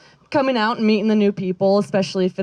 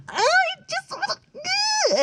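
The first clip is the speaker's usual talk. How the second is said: squealed very high, stretched out and slow, almost a strained cry; cute aggression squeal; 4 dB quieter, much slower than usual. Retold or imitated. Telling how she squeezes her cat, strained cute squeal. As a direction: squeeze the words out like you could burst over something cute.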